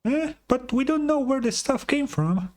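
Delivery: high, bright little companion voice; chirpy high fairy sidekick; Paimon voice. Acted.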